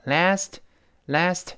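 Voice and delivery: "Last" with a flat ae and no final t; "last" with a wide ae, t swallowed; American last. Shown as a demonstration. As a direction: drop the t of "last". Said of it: "失去爆破了".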